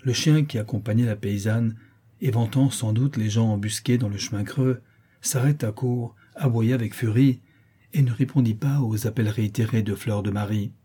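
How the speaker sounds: usual speaking voice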